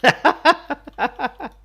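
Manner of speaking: high-pitched teasing through laughter; mock the sore loser, breaking up laughing; laughing taunt. Gamer taunting an angry player, high laughing voice.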